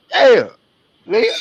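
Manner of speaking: a teasing wow called out to her; playful exclaim. Real, not acted. Playful exclaim at player's look.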